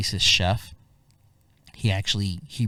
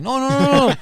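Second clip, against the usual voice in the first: much higher, louder no, no, no; frantic refusal; 10 dB louder than usual. Retold or imitated. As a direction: wave off the fight, alarmed and loud. Refusing a fight with driver.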